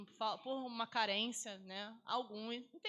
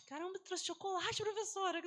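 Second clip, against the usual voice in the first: put-on much higher, delighted kid exclaiming; excited pupils; 8 semitones higher than usual. Teacher imitating pupils thrilled by the chocolate, high and excited. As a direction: squeal it like a thrilled child.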